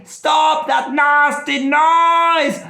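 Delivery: slow, high, loud furious yell; shouting at the plane. Acted. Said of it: "started shouting at the plane".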